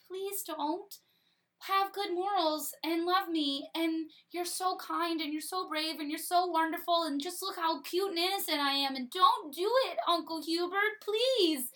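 put-on high, sweet, begging little-boy voice; pleading child Arthur. Arthur begging Uncle Hubert to spare him, high and sweet. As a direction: lay on the innocent cuteness to save your life. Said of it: "but Arthur is going".

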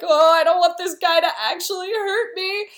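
high-pitched cowardly fretting; mock freak-out. Cowardly Andrew dreading the sword fight, mock panic. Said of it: "is freaking out because he's like".

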